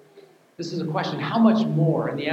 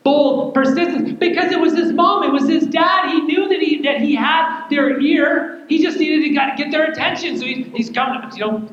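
higher, louder, half-sung preaching; preaching at full force; 10 semitones higher, 6 dB louder than usual. Acted. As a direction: preach it out, building.

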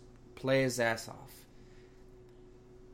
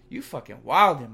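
much louder, calling him out hard; loud rant; 12 dB louder than usual. Real, not acted. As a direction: yell it right at him like he's lost it. Ranting at the player directly.

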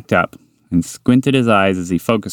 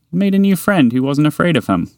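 usual speaking voice

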